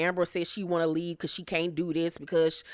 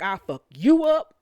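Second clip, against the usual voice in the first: mock threat; 5 dB louder than usual. Mimics Jocelyn's threat again.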